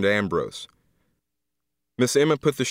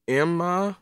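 comforting; 7 semitones higher than usual. Acted. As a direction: soothe a grieving friend. Aunt comforting Emma.

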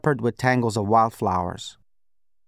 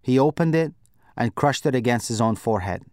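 ordinary delivery, nothing marked

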